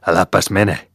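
loud, sharp shout after the bear. Acted. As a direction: bellow at the fleeing bear to stop. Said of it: "Jaako karjaisi". (kova huuto)

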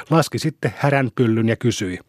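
usual speaking voice

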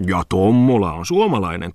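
mildly barbed jab; needling. A narrator's reading. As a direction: needle him with a slight lift. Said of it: "pisteli Muttinen". (piikittelevästi)

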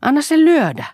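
very high, loud outcry; panting defiance. A narrator's reading. Boy being beaten by his father, heated panting defiance. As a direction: cry out, daring him to go on. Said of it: "huohotti Poju".

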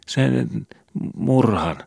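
put-on high, slow, faltering child's confession; trembling voice. Skobe confessing the murder to the detective, high and trembling. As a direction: quaver it out, barely daring to speak. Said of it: "vapisevalla äänellä".

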